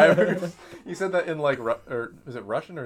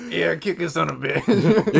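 louder, higher, slow cheer through laughter; laughing mock referee egging on a boxing match; cheering ringside; 5 semitones higher, 6 dB louder, slower than usual. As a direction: egg the fighters on like a rowdy referee. Referee [name] cheering the fight.

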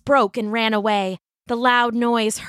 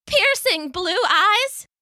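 high, slow, unsure squeak; small dog voice; 11 semitones higher, slower than usual. A narrator's reading. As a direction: guess it in a tiny voice. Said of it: "Pickles said".

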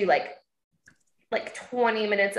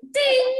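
high bell ding; 13 semitones higher, 5 dB louder than usual. Retold or imitated. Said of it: "she goes".

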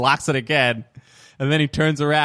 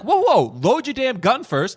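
urgent warning; 8 semitones higher, slower than usual. Retold or imitated. Viewer calling out to the character.

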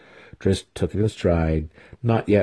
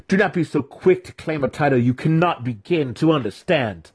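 higher-pitched scornful reprimand; chiding; 7 semitones higher than usual. A narrator's reading. Mentor rebuking his student's boast, chiding. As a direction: scold him with raised pitch. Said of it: "Zack chided him".

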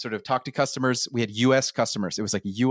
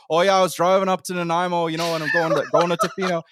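higher-pitched, hammed-up Canadian accent; 5 semitones higher, 4 dB louder than usual. Shown as a demonstration. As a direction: exaggerate a West Coast Canadian accent. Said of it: "And the Canadian accent's like".

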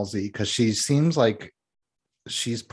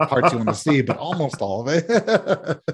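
higher-pitched, breaking into laughter; laughing; 5 semitones higher, 4 dB louder than usual. Real, not acted. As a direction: laugh through the cheeky aside. Cheeky joke about actor's body.

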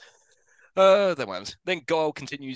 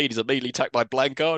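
normal talking voice

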